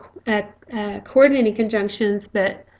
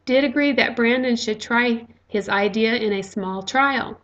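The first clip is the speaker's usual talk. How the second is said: usual speaking voice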